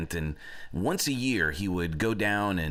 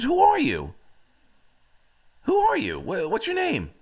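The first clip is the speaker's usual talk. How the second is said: high, loud acted demand; hammed-up confronting shout; urgent demand; 4 dB louder than usual. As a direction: fire questions at a fallen foe, pitched way up. Prince confronts the dying giant.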